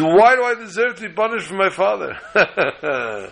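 high, drawn-out mock protest; indignant protest. Wolf in a fable objects to his punishment, high indignant protest.

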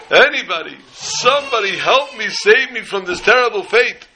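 mock desperate plea. Camper playing brother turns to the crowd, mock desperate plea. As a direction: beg the crowd to rescue you, hammed-up. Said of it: "crying look on his face".

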